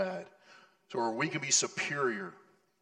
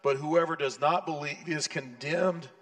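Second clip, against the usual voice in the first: scripture reading; 2 semitones higher, 4 dB louder than usual. Acted. Reads scripture in full voice.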